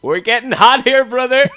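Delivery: high, slow shouted promo; wrestling promo. Retold or imitated. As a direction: bellow it like a wrestler on the mic. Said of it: "Ziggler looks at Barrett and goes".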